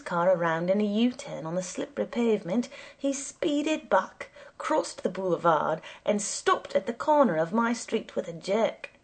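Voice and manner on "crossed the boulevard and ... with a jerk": usual speaking voice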